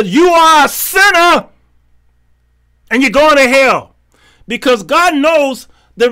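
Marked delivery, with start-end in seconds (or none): 0.0-3.9 hammed-up finger-pointing judgment; accusing preacher. Preacher mocking how some confront sinners, accusing. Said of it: "go to people and say".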